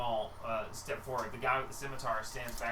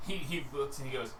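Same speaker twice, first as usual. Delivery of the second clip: ordinary delivery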